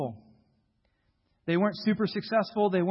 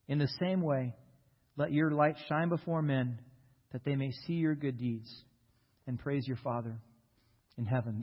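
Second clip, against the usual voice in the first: scripture reading; 3 semitones lower than usual. Acted. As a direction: read the passage with reverence. Reads scripture aloud.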